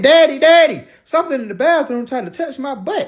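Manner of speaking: put-on a little girl waking her father in alarm; daughter's urgent plea. Daughter wakes dad at night, frightened child voice.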